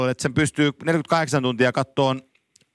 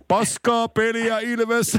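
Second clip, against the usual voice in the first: slow, higher, stretched-out mock yell; imitated heckle; 6 semitones higher, much slower than usual. Imitating the fan who heckles even when winning, drawn-out mock shout. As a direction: draw it out like a heckler in the stands. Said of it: "se huutaa pelissä".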